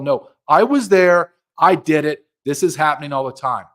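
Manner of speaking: forceful rebuttal. Retold or imitated. Voices insider rebutting doubters.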